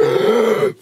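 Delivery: sharp shocked intake of breath; mock gasp. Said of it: "Hogs just gasped".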